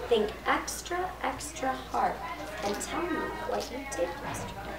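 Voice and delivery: very soft careful questioning; hushed, coaxing. A narrator's reading. Nurse asks Lulu to think back, hushed.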